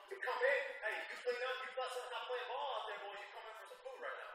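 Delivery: playful mock mom shout, ending on a laugh; mom voice calling. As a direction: call the kids in off the ball court. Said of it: "a mom or something yelling".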